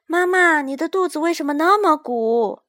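put-on a child's high, loud, eager call. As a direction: call out to mama, curious and excited. Child calls out to mama.

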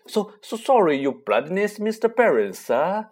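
oily high fawning; greasy groveling. Acted. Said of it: "he said greasily".

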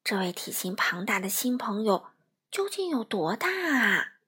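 ordinary delivery

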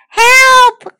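high, loud drawn-out cry; calling for help. A narrator's reading. Amanda calls for help from the bubbles, high alarmed shout. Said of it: "called Amanda".

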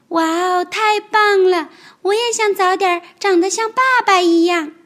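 高声童声，"太棒了"拖长，"爸"字落下; 崇拜地惊叹; 兴奋惊叹. Acted. 小恐龙看得佩服. (小恐龙高声兴奋地赞叹)

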